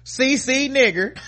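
high, loud, slow, breaking into laughter; incredulous laughing. Real, not acted. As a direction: read it out cracking up. Incredulous reading of letter.

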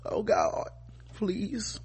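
whispered, half-joking plea to God; mock tearful plea. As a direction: breathe it out like a fake prayer. Mocking the crying preacher's prayer.

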